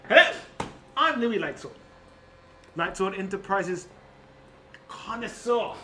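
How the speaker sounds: boastful magic-item connoisseur with grand pauses; Louis Lightsword. Acted. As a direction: announce yourself grandly as a famed inventor. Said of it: "as an example with Louis Lightsword".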